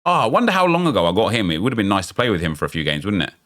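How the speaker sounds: usual speaking voice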